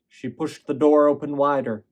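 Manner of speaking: plain reading voice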